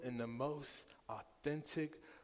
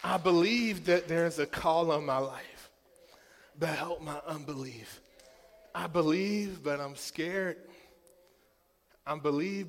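impassioned preaching; 3 semitones higher, 9 dB louder than usual. Acted. Pastor preaching his own prayer for his daughter, loud. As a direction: cry it out like a prayer.

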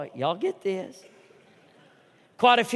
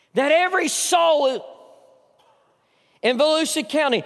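preaching at full force; 5 semitones higher than usual. Acted. Pastor crying out to Volusia County, full-voice shout.

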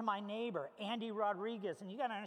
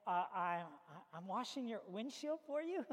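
stammering, hesitant acted excuse; mock-sheepish stammer to a scary neighbor; lost for words; 3 semitones lower than usual. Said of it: "I don't know what to say".

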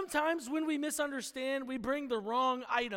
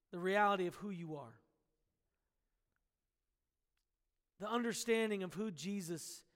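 soft, earnest pastoral prayer, fading softer; heartfelt prayer; 4 semitones lower, 4 dB quieter than usual. Real, not acted. Pastor praying over the church on Palm Sunday, soft and earnest. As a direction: pray it sincerely, voice sinking low toward the end.

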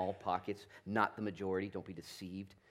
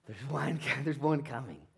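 higher, louder joyful call; mock smile-yell of John; 6 semitones higher, 7 dB louder than usual. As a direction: call it out with a grin. Wondering if John yelled.

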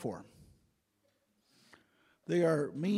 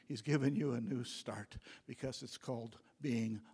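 whisper; 5 semitones lower, 5 dB quieter than usual. Real, not acted. Preacher whispering the closing words of his point to the congregation. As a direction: drop to an unvoiced whisper for the last words. Quiet end of a sermon point.

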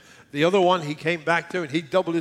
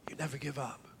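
soft drop; 15 dB quieter than usual. Real, not acted. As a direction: say it gently, almost whispered. Drops to soft close.

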